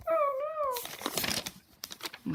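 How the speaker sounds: high, drawn-out cartoon alarm; mock dismayed cry. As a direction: stretch it out in pretend panic. Acted character tackled by chief.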